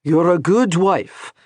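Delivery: calm her down, slowly; reassuring husband. A narrator's reading. Gabriel reassures.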